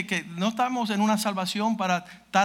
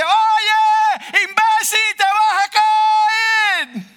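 high, loud yelled warning; shouted warning, slow on the last words; 11 dB louder, much slower than usual. Retold or imitated. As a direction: yell it at the fool leaning over the edge. Calling warning to someone near crater.

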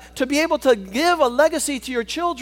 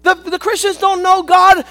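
full-force preaching; 5 semitones higher, 6 dB louder than usual. Acted. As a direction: preach it hard to the congregation.

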